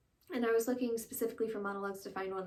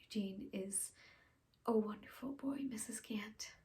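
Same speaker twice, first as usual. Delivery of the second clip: reflective stage confession with long pauses; dramatic monologue. Acted. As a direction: confess haltingly, letting each thought settle. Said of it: "I'll be doing a monologue".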